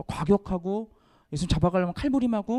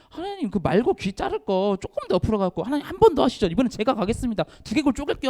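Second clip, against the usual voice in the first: higher, bragging sidekick banter; mock eager disciple; 5 semitones higher than usual. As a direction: joke like a hotheaded disciple volunteering. 제자들이 말고 귀 자르며 농담. (장난스럽게 나서는 제자 흉내)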